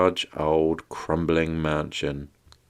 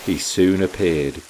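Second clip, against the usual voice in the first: plain reading voice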